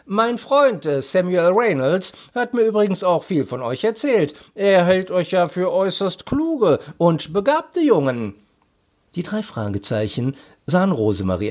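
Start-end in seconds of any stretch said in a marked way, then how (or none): 0.0-8.4 high, lilting woman's character voice; animated and high, paying a compliment; friendly commissioner. A narrator's reading. Commissioner passes praise on to the boys, friendly.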